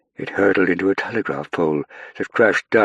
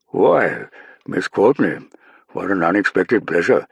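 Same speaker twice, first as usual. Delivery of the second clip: Churchill; 3 semitones higher, 5 dB louder than usual. Acted. Churchill greets Saffron.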